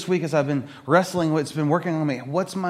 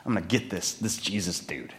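arrogant; 8 semitones lower, 5 dB quieter than usual. Acted. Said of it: "this sense of arrogance".

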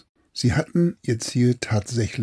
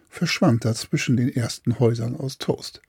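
usual speaking voice

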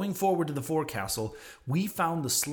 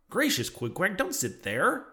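higher-pitched, startled objection; mildly shocked protest; 5 semitones higher than usual. A narrator's reading. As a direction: object with a touch of alarm. Alarmed at friend sitting on sleeper.

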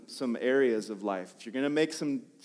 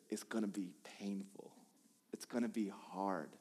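very soft, low, grave delivery; quiet, sober retold warning; hushed warning; 5 semitones lower, 14 dB quieter than usual. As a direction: deliver a hard truth almost under the breath. Retells God's warning.

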